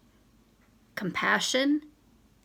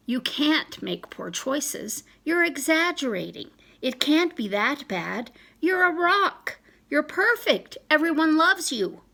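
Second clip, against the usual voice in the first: gushing friend; 6 semitones higher than usual. Retold or imitated. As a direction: pump them up like a friend in denial. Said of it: "Oh, let me start over".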